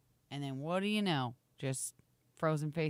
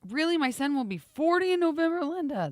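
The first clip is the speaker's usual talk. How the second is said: mock surprised; 9 semitones higher, 5 dB louder than usual. Mock reading old comment.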